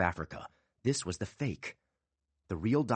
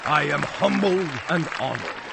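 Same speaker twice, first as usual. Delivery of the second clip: showman voice; 8 semitones higher, 7 dB louder than usual. Acted. Bosso bowing.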